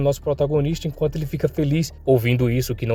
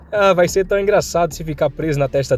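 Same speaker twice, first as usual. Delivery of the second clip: higher, jeering bully voice; gleeful mockery; 5 semitones higher than usual. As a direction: tease him gleefully in a higher voice. Bully in back mocks the victim.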